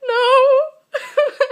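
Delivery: a loud high "No." broken by real laughter; loud laughing "No." said to husband, pretending she isn't crying; laughing denial. Real, not acted. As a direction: blurt out the denial while laughing. Her denial while crying, retold.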